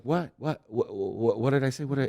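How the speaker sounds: mock-panicked repeated questioning; retold nervous stammering. Dad retelling his reply to his kids, flustered. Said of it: "I got totally nervous".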